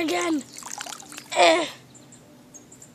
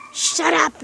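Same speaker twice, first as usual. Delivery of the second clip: normal talking voice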